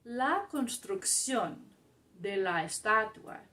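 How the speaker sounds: slow, deliberate Spanish reading with pauses between phrases; careful teacher read-aloud. Shown as a demonstration. Teacher reading a Spanish article to a learning group, slow careful read-aloud.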